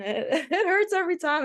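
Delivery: laughing through the words, voice jumping high; giggling about getting hurt. Real, not acted. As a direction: laugh it off as you say it. Laughs about broken noses.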